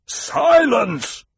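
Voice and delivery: roaring tyrant king. A narrator's reading. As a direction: roar it to silence the room. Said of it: "the Emperor roared".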